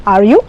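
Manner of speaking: short question, YOU swept upward; rising tag question. Shown as a demonstration. Teacher fixing a tag question, rising on YOU. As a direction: flick the pitch up on YOU. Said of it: "The rising intonation".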